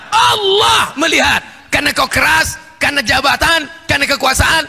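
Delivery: high-pitched shouted preaching; thundering warning. Real, not acted. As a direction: thunder the warning at full force. Preacher thunders warning at full force. (seruan peringatan lantang bernada tinggi)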